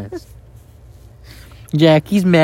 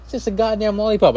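exasperated protest; 9 semitones higher than usual. Real, not acted. Exasperated at angry partner.

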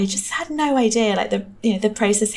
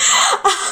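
laughing; 12 semitones higher, 4 dB louder than usual. Real, not acted. Laughs at own only-child remark.

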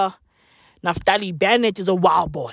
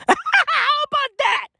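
laughing squeak; slower than usual. Real, not acted. Laughing at own joke.

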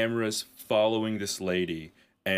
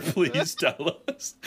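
laughing sarcastic plea; 7 semitones higher, much slower than usual. Retold or imitated. Sarcastic request through laughter.

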